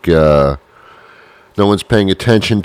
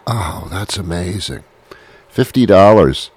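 ordinary talk, nothing marked

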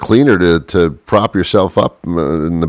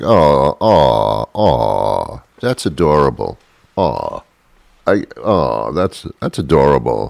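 touched; 5 semitones lower, slower than usual. Retold or imitated. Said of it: "it's like".

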